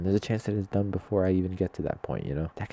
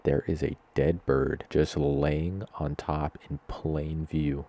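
ordinary talk

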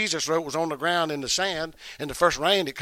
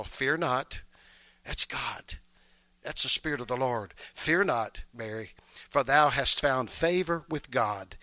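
scripture reading; 5 semitones lower, 3 dB quieter than usual. Acted. Pastor reading Luke to the church, soft and reverent. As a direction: read the angel's words gently.

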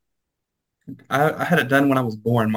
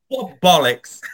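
slow, higher mock British; imitated British insult; 5 semitones higher, slower than usual. Joking about British cussing, mock British accent. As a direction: savour it in fake British. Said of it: "over there, they're like".

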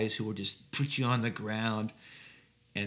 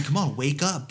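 mock conspiracy nut; 3 semitones higher than usual. Mocking conspiracy bigot.

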